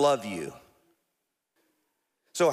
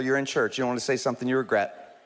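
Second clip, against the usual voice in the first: usual speaking voice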